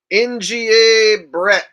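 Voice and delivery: high, loud, slow announcer call; booming drawn-out donor shout; hyped name call. Acted. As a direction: stretch the letters out like a ring announcer. Reading out a super sticker name.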